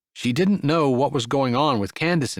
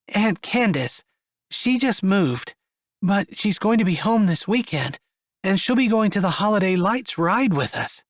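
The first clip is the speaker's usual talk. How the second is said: put-on higher young woman, explaining; 7 semitones higher than usual. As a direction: lift into a bright explaining voice. Esther voiced.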